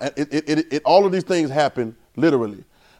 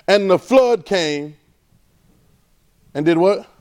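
drawn-out preaching read of the verse; scripture reading; 3 semitones higher, slower than usual. Acted. Pastor reading scripture to congregation, drawn out. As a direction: stretch each phrase for the congregation.

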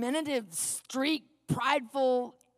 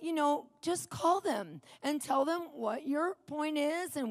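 higher, soft, slow placating voice; measured and non-confrontational, as if handling someone gently; acted-out patient soothing; careful forced calm; 5 semitones higher, 4 dB quieter than usual. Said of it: "Trying to be calm and non-prideful".

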